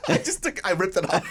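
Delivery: usual speaking voice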